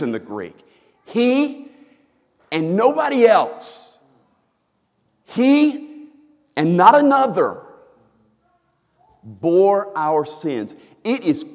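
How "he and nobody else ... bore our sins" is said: "He" hit high and loud, "bore our sins" slowed; emphatic He. Shown as a demonstration. Preacher stressing the Greek pronoun, emphatic He. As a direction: hammer the pronoun He. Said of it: "The language there is very emphatic".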